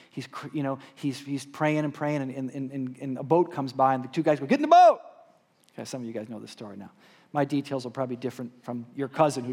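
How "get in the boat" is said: high, loud rescuer's shout; urgent acted holler; calling out. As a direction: yell across the water to a stranded man. Rescuers calling to flood victim.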